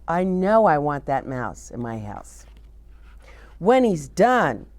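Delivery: ordinary delivery, nothing marked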